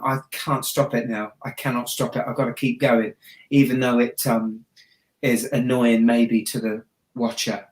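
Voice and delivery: usual speaking voice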